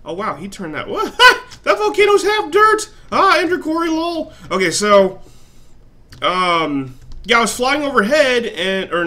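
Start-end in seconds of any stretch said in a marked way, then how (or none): 0.9-4.3 much higher, louder laughing squeal; high laughing exclaim; laughing surprise. Real, not acted. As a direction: burst out laughing at the sight.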